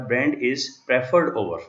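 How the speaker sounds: very slow, word by word, reading the table aloud; deliberate teacher dictation pace. Shown as a demonstration. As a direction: spell out "brand is preferred over" slowly for students. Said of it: "लेकिन इसको पढ़ा ऐसे जाएगा".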